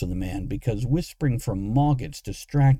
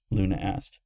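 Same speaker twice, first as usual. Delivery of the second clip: ordinary delivery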